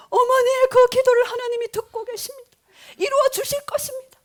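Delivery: high, loud prophetic declaration through tears; imitated tearful proclamation. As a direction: act out a weeping teen declaring God's promise. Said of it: "눈물을 흘리면서". (울먹이며 외치는 예언 흉내)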